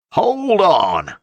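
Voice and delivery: high, loud roar of alarm. A narrator's reading. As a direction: yell it as he's hauled aboard. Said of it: "roared Uncle Salters".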